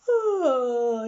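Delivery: a big loud yawning "Oh"; sleepy Daddy Pig yawning loudly. A narrator's reading. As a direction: yawn the word out loudly. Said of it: "yawned Daddy Pig very loudly".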